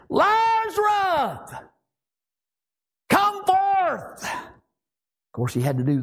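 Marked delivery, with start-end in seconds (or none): 0.1-1.5 long, high commanding shout; crying out. Acted. Jesus at the tomb calls the dead man out, shouted. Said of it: "he cried out". 3.1-4.2 high, ringing shouted command; crying out. Acted. Jesus orders Lazarus out of the tomb, shouted. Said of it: "he cried out".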